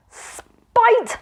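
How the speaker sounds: high, loud enraged shout; outraged cry. A narrator's reading. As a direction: explode on the word. Magician erupts in rage.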